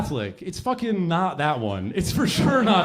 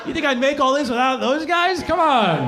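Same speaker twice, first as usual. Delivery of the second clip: laughing it off; 6 semitones higher, slower than usual. Retold or imitated. Jesus laughing off slaves.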